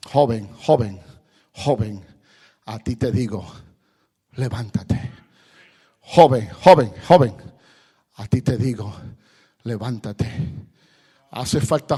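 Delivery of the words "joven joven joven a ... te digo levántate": preaching call. Acted. Preacher calls the young people to rise, urgent sermon call. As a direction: summon them to stand.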